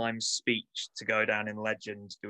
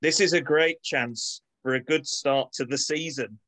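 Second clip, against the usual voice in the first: put-on Manchester accent; 5 semitones higher than usual. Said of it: "in my best Manchester accent".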